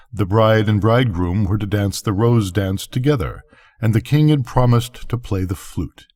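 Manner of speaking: usual speaking voice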